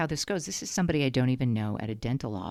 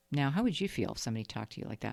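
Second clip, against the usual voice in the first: ordinary talk, nothing marked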